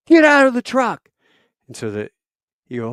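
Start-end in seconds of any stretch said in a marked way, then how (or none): 0.1-1.1 high, loud barked command; furious officer shouting; shouted order. Retold or imitated. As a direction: yell the order straight at him. Jay ordering the man out.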